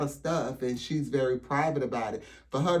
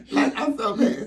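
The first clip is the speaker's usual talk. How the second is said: laughing; 11 semitones higher, 8 dB louder than usual. Real, not acted. Laughing at her own look.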